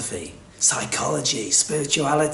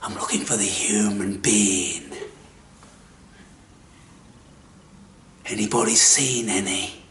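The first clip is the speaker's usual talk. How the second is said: put-on old seeker answering in a whisper; whispered reply; 4 semitones lower, 4 dB quieter than usual. Old man whispers his answer to the mocking stranger. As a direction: hush it like a weary secret. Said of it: "And he replies".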